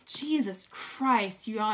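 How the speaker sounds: usual speaking voice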